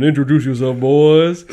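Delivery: ordinary talk, nothing marked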